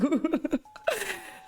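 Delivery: high-pitched giggle; nervous laugh. Real, not acted. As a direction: let out a high, helpless laugh. Laughs at living his nightmare. (petit rire nerveux aigu)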